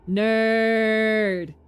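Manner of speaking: loud, drawn-out teasing jab; mocking jeer. Real, not acted. Streamer mocks the nerdy doctor on screen, loud teasing. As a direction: sneer it out at him.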